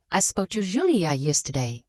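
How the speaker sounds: "Julia" picked out as the strongest word; stress on Julia. Shown as a demonstration. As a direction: lean on "Julia", meaning her and nobody else. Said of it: "this one Julia and not the other person".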